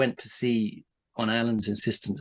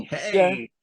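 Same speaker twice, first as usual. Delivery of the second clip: higher, hammed-up American comic patter; mock American stand-up; 7 semitones higher, 3 dB louder than usual. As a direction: play to the crowd like a smug newlywed comic. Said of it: "to be showing the ring".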